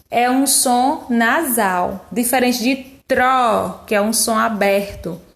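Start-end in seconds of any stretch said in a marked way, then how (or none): none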